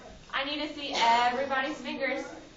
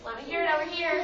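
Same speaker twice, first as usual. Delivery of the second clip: ordinary delivery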